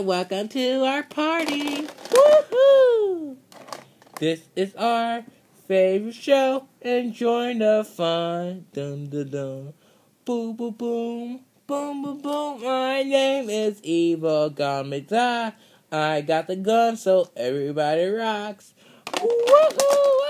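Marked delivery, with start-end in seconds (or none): 2.1-3.4 high, loud happy whoop; excited woohoo. Pretend. Kid cheering welcome. 19.1-20.2 high whooping cheer; excited woohoo. Pretend. Villain cheers.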